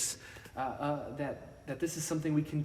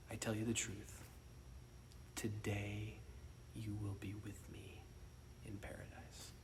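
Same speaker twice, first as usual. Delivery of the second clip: much softer, dying into a whisper; hushed to a whisper; 4 semitones lower, 12 dB quieter than usual. Acted. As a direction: breathe the promise gently. Jesus' promise, hushed.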